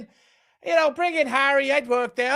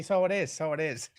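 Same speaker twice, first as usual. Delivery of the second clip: a light Irish lilt, fading to a laugh; mock Irish lilt; 7 semitones lower, 8 dB quieter than usual. Teasing a friend about his goulash, light mock Irish lilt. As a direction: toss off a jokey Irish tag. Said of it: "we're doing it again".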